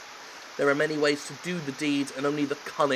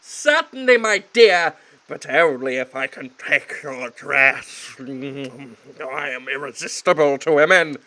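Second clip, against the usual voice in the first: loud, slow, laughing smarm; smarmy lech; 7 dB louder, slower than usual. Acted. Heir hitting on the maid, smarmy. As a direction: ooze cheesy charm.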